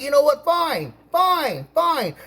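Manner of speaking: high, exasperated retold surrender; exasperated giving in. Acted giving in to nagging landlady.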